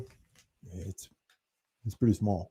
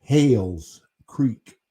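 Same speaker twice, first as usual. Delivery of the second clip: Hales Creek said slowly and a bit louder, each word spelled out; slow, clear enunciation; 5 dB louder, much slower than usual. Shown as a demonstration. Repeating it to the voice search that misheard, slow and clear. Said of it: "it doesn't not understand my American accent".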